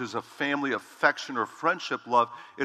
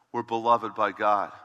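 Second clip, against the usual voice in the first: normal talking voice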